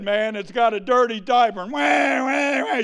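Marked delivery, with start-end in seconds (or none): 1.7-2.8 imitating a wailing baby, higher; hammed-up infant wail; mock baby cry. A parent's crying baby, mock wailing. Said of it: "your little kid".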